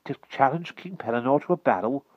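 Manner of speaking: put-on quick eager young lad; hasty boy. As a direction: rush the question to change subject. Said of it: "hastily changing the subject".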